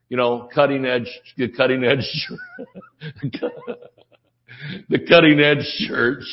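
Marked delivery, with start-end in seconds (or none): 3.0-3.7 chuckle. Real, not acted. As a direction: chuckle quietly at your own joke. Chuckles at own cutting edge joke.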